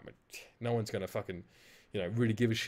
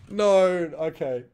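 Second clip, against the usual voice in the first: drawn-out slow "no, okay"; dismayed groan; 7 semitones higher, 11 dB louder, much slower than usual. Real, not acted. Streamer dreading his upcoming slip. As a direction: sigh it out in dread. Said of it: "I'm really upset about this".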